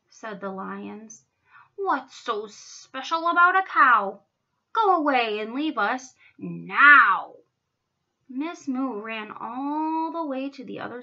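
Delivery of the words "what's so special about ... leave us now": loud, gruffly dismissive lion; scornful dismissal. A narrator's reading. Lions chase Miss Moo away, scornful and loud. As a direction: send the cow off loudly.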